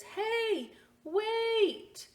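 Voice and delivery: very high shout after someone; calling out. Acted. Little boy running after the man with his hat, calling. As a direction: call after him brightly.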